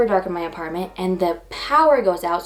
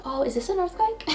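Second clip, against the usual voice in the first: surprised; 10 semitones higher, 6 dB quieter than usual. Retold or imitated. Retold sudden realisation.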